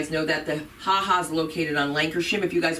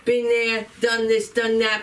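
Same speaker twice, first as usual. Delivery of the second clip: ordinary talk, nothing marked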